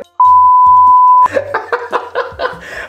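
high squealing burst of laughter; stifled giggles. Real, not acted. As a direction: break into a loud, high giggle.

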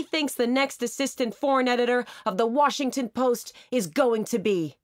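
rally the spectators with it; hammed-up campaign pitch, shouted high. A narrator's reading. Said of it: "he shouted".

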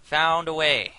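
loud, bright relief; excited relief. Real, not acted. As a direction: announce the fix with relief. Relieved, buddies found way.

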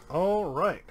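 ordinary delivery

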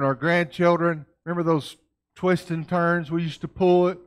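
ordinary talk

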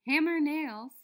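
ordinary delivery

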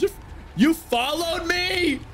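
high, drawn-out question; incredulous high cry; indignant disbelief. Real, not acted. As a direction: stretch it high in outraged surprise. Player finds enemy has followed them.

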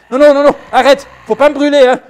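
loud, high, frightened pleading; frightened shout. Acted. Knight begging the fire-breathing dragon to stop, loud and frightened. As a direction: beg the dragon not to burn her.

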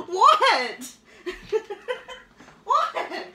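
laughing high and loud through a startled what. Real, not acted. Catching her own wording slip, laughing high and loud. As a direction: crack up at the slip. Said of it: "Rich said I worded that funny".

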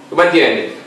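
ordinary delivery

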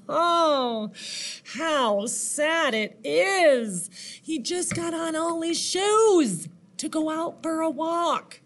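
high, drawn-out pity; hammed-up "poor thing" lament; mock sympathy. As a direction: play up the sorrow for a little listener. Reader pities the centipede.

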